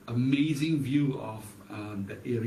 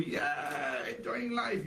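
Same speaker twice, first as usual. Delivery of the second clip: excited shout; 8 semitones higher, 4 dB quieter, much slower than usual. Real, not acted. Preacher to the audience, a high, excited shout. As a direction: shout it out like praise breaking loose.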